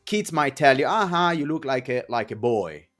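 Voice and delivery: normal talking voice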